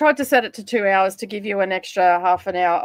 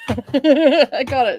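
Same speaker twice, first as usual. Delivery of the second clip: slow, laughing words of triumph; laughing relief; 3 semitones higher, 6 dB louder, much slower than usual. Real, not acted.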